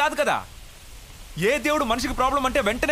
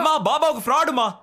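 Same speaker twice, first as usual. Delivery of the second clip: louder, higher, pressing protest; insistent outburst; 5 semitones higher, 4 dB louder than usual. Acted. Son insists to mother the baba is a fraud, raised voice. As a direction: force mother to listen.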